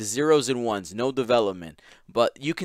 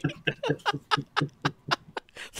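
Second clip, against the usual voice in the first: high, long giggling fit; real high-pitched cracking up; helpless laughter; 4 dB quieter than usual. Real, not acted. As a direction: laugh like the joke is killing you. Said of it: "you guys are killing me".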